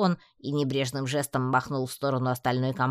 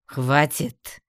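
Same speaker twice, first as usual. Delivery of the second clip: commanding, mildly raised voice; booming interruption; 4 dB louder than usual. A narrator's reading. Emperor silences the amazon, booming. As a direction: cut her off with authority. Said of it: "громогласно прервал амазонку император".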